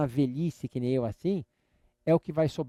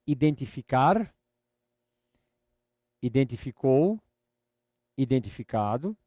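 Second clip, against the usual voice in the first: slow, drawn-out model words, pausing after each; teacher's slow chant of a verb list; repeat-after-me drill; 3 semitones lower than usual. Shown as a demonstration. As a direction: stretch each word out for a learner to repeat.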